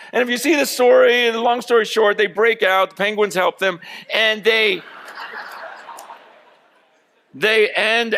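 high, loud, driving sermon voice; full-force preaching. Acted. Pastor stirring his congregation about leaving the cage, full force. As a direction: preach it hard, rising to the crowd.

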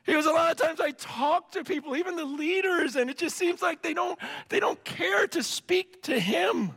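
half-cry it like a heartbroken friend on the phone; retold tearful plea. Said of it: "And he's crying".

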